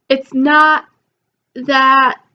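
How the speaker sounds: normal talking voice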